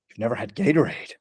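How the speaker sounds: halting, low-key stunned repeat; imitated disbelief. Store guy to first-time Gatorade buyer, imitated disbelief. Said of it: "he just looked at me".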